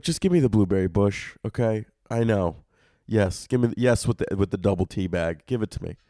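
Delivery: low, hushed customer reply; embarrassed ordering. Acted. Customer embarrassed to order at Starbucks, low sheepish voice. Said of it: "It's just embarrassing".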